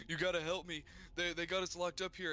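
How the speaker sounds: acted panic, pitched well up; freaking out. Cannibal leader's son begs the minotaur for help, freaking out. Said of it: "he was, like, freaking out".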